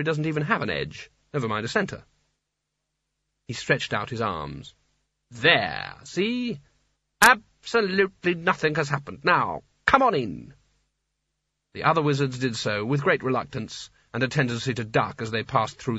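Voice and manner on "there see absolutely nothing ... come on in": slow, coaxing show of confidence; Sconner, confident leader. Acted. Sconner coaxes reluctant wizards through the arch, confident. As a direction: reassure them with emphatic, drawn-out words.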